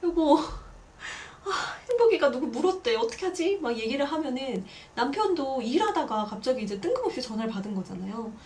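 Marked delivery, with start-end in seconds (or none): 0.0-3.6 higher, flustered mock panic; panicked call. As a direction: stammer it out like a startled mom phoning her husband.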